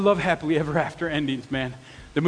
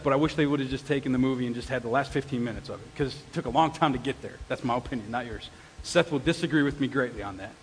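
ordinary talk, nothing marked

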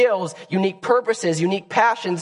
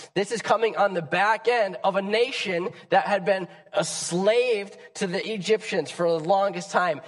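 usual speaking voice

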